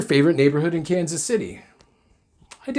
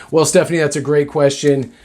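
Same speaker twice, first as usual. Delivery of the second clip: normal talking voice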